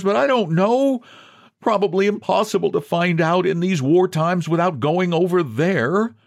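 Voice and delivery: high, plaintive explanation; plaintive man with no proof to give; pleading John. Acted. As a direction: keep the pitch up, helplessly explaining. John explains his hopeless case.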